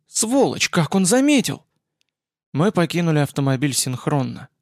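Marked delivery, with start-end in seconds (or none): 0.1-1.6 sharp angry outburst; angry shout. A narrator's reading. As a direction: spit it out furiously. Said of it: "Эсфирь крикнула зло".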